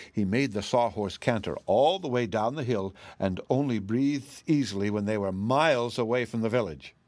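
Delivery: ordinary delivery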